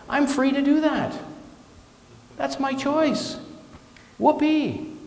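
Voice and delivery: high, mock-cheerful sarcasm; sarcastic whoopee. Minister mocks choosing to stay angry, high sarcastic cheer. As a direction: toss it off with mock glee.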